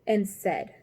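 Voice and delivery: usual speaking voice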